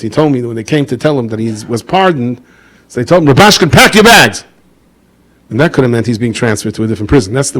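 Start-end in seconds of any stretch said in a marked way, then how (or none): 3.3-4.4 loud, higher call across the cell. Retold or imitated. Guards call out to the prisoner, loud and higher. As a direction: bark the order to pack up.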